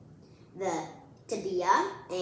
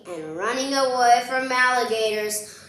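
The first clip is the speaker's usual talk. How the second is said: loud and higher, building alarm; excited; 5 semitones higher, 8 dB louder than usual. Acted. Reading to kids, alarmed about alligators. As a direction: shout it like alligators are coming.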